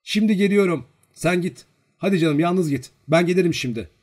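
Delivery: high, loud brush-off; impatient shooing. Acted. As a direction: shoo a younger sibling away loudly. Girl sends little sibling away.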